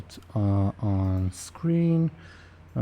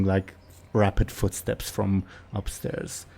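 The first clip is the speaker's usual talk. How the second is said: ordinary delivery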